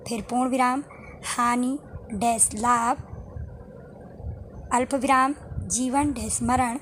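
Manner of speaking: slow, pausing at each comma and dash; slow model reading for students; slow demo reading. Shown as a demonstration. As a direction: read the examples slowly, pausing at each punctuation mark. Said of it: "आप थोड़ा रुकेंगे".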